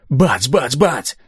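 high, punched "bang" words; imitated gunshots. A narrator's reading. Narrator as Denfort's men open fire, punched gunshot imitation. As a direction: punch out each shot with jumping pitch.

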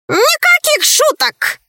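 high-pitched squawky bird voice, drawn out slowly; crying-out talking bird. A narrator's reading. Bird's right head protests it is no joke, high cry. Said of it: "закричала правая голова говоруна".

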